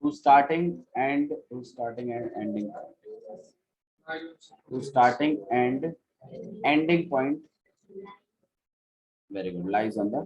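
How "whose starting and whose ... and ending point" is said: slow dictation. Shown as a demonstration. Teacher dictating definition to students.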